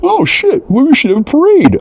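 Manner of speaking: very high, goofy sudden idea; mock high-voiced realization. Mocking the people stuck here, high goofy voice. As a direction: squeal out the idea like a cartoon. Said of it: "figuring out".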